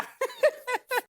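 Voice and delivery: high cackling giggle; mocking laugh. Workman laughs at the man. (ऊंची खिल्ली भरी हंसी)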